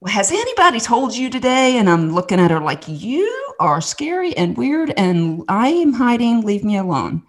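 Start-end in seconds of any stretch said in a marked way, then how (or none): 0.1-1.8 high, put-on Southern lady voice; Southern accent. Lady greeting the hiding kid, imitated Southern accent. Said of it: "I can't even do her Southern accent". 2.9-7.2 high, slow, put-on wary protest; guarded kid. Hiding child to strange lady, imitated wary protest.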